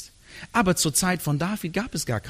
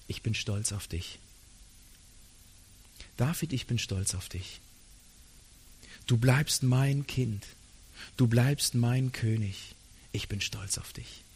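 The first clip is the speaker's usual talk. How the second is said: tender whisper of God; 5 semitones lower, 7 dB quieter than usual. Acted. God reassures broken David, gentle soft and low. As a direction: lower and much softer, like a father leaning close.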